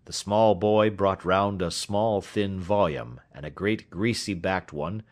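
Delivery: usual speaking voice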